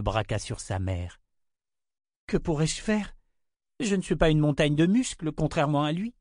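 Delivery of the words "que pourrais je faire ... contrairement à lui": put-on higher, doubtful child's voice; a small girl protesting she's no strongman; young girl. A narrator's reading. Rachel telling her mother she is not strong, high childlike doubt.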